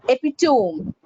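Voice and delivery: "epitome" as EPI-tome, three syllables; "epitome" with silent final E; demonstrated pronunciation. Shown as a demonstration. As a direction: end "epitome" like home. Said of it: "It's pronounced as".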